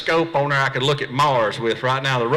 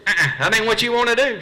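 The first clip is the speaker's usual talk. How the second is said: mock agent; 8 semitones higher than usual. Agent voiced higher.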